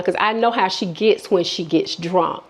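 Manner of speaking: ordinary talk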